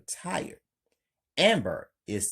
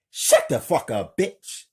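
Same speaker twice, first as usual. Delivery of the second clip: loud, punchy order to shut up; hostile command; 4 semitones higher, 9 dB louder than usual. Acted. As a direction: punch each word out loudly. Killer silences the screaming cousin.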